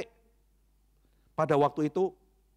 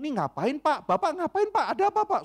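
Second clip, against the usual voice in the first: rising ever higher, confused questions; bewildered bystanders; 10 semitones higher than usual. Retold or imitated. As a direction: sound baffled by the fight. Bewildered bystanders asking what's wrong. (orang-orang bingung, nada naik tinggi)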